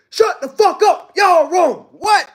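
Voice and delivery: high, loud shot-back retort; mock retort. Speaker answering a hundred thousand doubters, loud. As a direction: bite back at the room. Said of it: "I'm gonna be like".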